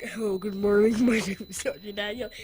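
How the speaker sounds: loud, mock-deep greeting; pretend deep voice. Boy greeting as a new teenager, mock deep voice. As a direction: fake a grown-up deep voice. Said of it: "Even if I don't have a deep voice".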